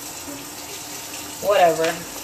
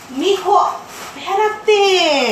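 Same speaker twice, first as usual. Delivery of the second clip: high, loud, drawn-out order to wait; calling, exasperated; 9 semitones higher, 5 dB louder, much slower than usual. Real, not acted. Mom telling her son to wait, exasperated.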